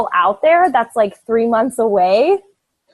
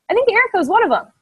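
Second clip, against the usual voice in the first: excited aside; 10 semitones higher, 4 dB louder, much faster than usual. Real, not acted. Excited aside about Erica.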